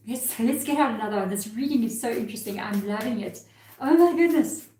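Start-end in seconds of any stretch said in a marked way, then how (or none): 3.8-4.7 delighted exclamation. Real, not acted. Delighted at the card.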